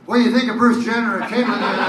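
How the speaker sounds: loud, fast, laughing trap question; spring the trap on the celebrity; sneaky gotcha question. Retold or imitated. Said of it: "And then he goes".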